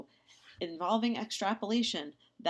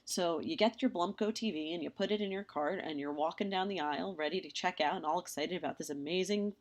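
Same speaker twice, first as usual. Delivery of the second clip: usual speaking voice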